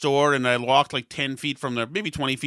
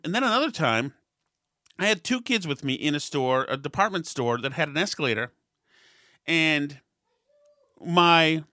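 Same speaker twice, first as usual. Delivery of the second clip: ordinary talk, nothing marked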